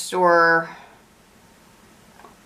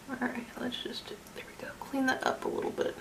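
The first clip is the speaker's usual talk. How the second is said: very soft, near-whispered aside; barely voiced self-talk; murmured to self; 3 semitones higher, 16 dB quieter than usual. Real, not acted. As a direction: murmur it under your breath as you work. Talking to self while working.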